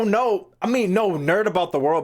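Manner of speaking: usual speaking voice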